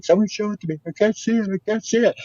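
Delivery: high, imitating a girl pleading in tears; mock crying girl. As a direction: play the girl who can't see it, half-wailing. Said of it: "she's crying".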